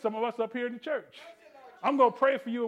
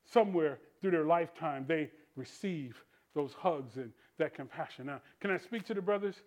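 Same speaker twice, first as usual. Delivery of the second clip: ordinary talk, nothing marked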